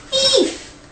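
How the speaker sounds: very high cry at talking volume; hammed-up high yelp; mock shout. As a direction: squeal the alarm without real force. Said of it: "Bernard began to shout".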